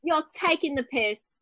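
slow, exaggerated British accent; Love Island accent. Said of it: "that just sounds downright disgusting".

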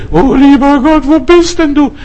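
high, whiny mock plea to a distant God; mock pleading prayer. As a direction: parody a lost soul crying out to God. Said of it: "Ich stehe nicht hier und bete".

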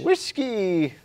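high mock Irish cry; fake Irish accent. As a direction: toss it out in a put-on Irish brogue. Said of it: "fake Irish accent that he does".

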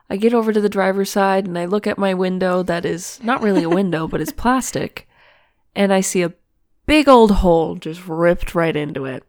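usual speaking voice

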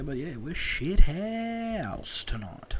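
drawn out, slowly; slow mock confession. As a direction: drag the words out slowly. Retold own blunt reply.

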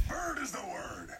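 fast breathy whisper; imitating a secretive bird; whispered mock line. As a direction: whisper the catchphrase quickly. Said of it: "Colonel Wing".

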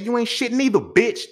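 telling off the grandma, a loud high retort; retold shout. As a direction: snap it back at her loud and high.

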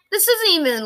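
sighing, voice wet with tears; tearful sigh. Real, not acted. Grieving sister sighs about being told not to cry, tearful. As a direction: let a sigh break into tears before the words.

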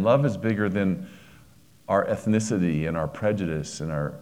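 ordinary talk, nothing marked

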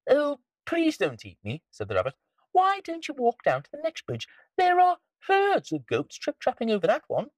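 very high little rabbit. Acted. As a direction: speak like a small bouncy rabbit. Rabbit pleads.

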